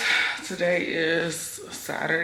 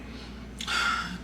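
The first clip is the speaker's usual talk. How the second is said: exasperated sigh; 3 semitones higher, 4 dB quieter than usual. Real, not acted. Exasperated mom telling kid story.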